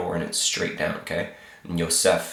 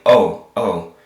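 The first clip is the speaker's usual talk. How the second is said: louder mock call of Oh, oh from a cartoon figure; little figure saying oh; 8 dB louder than usual. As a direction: voice the little man catching the ball above his head. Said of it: "he's saying".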